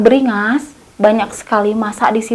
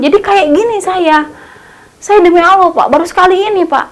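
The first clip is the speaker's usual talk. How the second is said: begging for mercy; 6 semitones higher than usual. Retold or imitated. Thief clings to the officer's legs, begging for mercy. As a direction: beg the officer to spare you. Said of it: "si tersangkanya bilang kayak gini".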